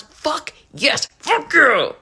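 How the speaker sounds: replayed cinema yelling; cussing at the screen. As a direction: yell it like in the theater. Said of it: "I was just cussing".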